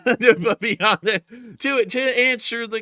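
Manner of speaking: slow, high laughing speech. Real, not acted. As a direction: laugh through the words. Laughing, pitch up.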